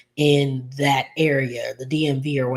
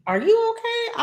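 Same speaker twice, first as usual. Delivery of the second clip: much higher, squeaky mock disbelief; mock high squeal. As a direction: squeal it like she can't believe her. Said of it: "And Vanessa is just like".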